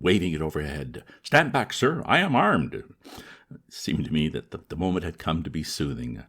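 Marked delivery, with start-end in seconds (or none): 1.2-2.7 loud high bark of Stand back; shouted warning. Acted. Old man warning off the young man, shouting. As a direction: threaten with the umbrella raised. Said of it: "he shouted".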